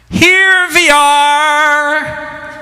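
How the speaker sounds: high, very slow, drawn-out joyful call; grandma's greeting. Retold or imitated. Grandmother welcoming her grandsons at the door, a long high happy call. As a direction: sing it out like a grandmother flinging open the door. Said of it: "she would greet us at the door".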